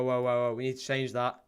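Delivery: ordinary talk, nothing marked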